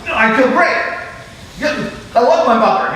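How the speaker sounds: bright, too-quick insistence she feels great; forced cheerful denial. Retold or imitated. Client insisting to her therapist, forced cheer. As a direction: pretend all is fine, overselling it.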